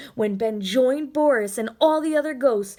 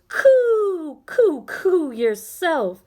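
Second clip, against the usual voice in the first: much higher, slow cooing dove; a dove answering Ben, higher, cooing; soft sweet dove; 7 semitones higher, 9 dB louder, much slower than usual. Acted. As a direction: coo it high like a dove. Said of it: "came a soft, sweet voice".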